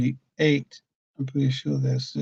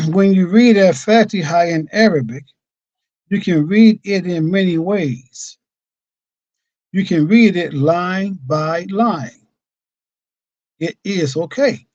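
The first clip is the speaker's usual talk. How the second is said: louder, higher, measured reading voice; formal reading aloud; 5 semitones higher, 6 dB louder than usual. Acted. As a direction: proclaim the teacher's written words deliberately. Reads the Imam's words aloud.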